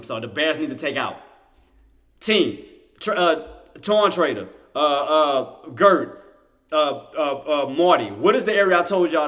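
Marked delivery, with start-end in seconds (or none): 2.2-9.3 loud quiz to the team; calling out. Real, not acted. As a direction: call on them by name, loudly, searching for names. Quizzing viewers by name.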